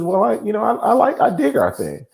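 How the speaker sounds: put-on child voice. Dad voicing his young son.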